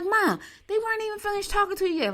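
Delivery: very high-pitched mock protest; retold protest. Daughter objects to mom ending the call, protesting. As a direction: exclaim in disbelief at mom, imitated.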